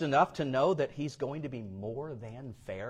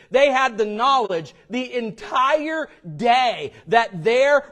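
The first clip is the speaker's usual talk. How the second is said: loud, higher, slowing emphasis on reward and all day; full-force preaching; 10 semitones higher, 11 dB louder than usual. Acted. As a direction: preach it out with weight.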